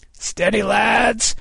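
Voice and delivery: high-pitched captain's call to the crew; Kidd calling out. A narrator's reading. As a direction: call out to steady your men. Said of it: "he called".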